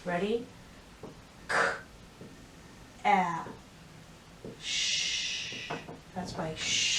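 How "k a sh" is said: each sound apart, sh long and voiceless. Shown as a demonstration. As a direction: show how cash splits into k, a, sh. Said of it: "so I'll show that to you".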